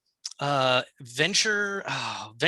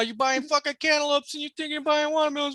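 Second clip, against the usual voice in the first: mock rant; 7 semitones higher than usual. Mocking rant at Ma.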